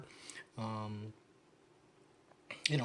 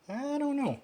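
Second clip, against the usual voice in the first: high squeak; 4 dB louder than usual. Real, not acted. Doubtful squeak after quote.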